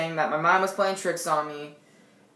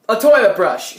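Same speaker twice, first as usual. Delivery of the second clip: much louder, mock-desperate blurt; loud frantic answer; 3 semitones higher, 10 dB louder than usual. Teen offering a weapon to a friend, loud mock-frantic blurt. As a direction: blurt it out loudly.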